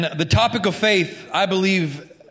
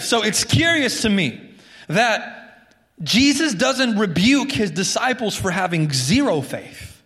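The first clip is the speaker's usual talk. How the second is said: ordinary talk, nothing marked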